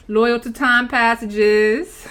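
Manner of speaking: slow, stretched-out proclamation; drawn-out declaration. Pretend. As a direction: stretch every word out grandly.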